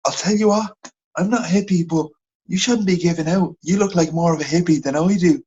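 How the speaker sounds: high-pitched imitation of a Dublin woman; Dublin accent. Shop worker tells off a customer, imitated Dublin accent. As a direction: put on a Dublin shop lady, pitched well up. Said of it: "she's a bit of a double accent like you".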